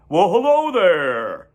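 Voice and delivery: slow, beaming hello; smiling greeting. A narrator's reading. Mr Zuckerman greets the goslings with a big smile. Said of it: "smiling all over".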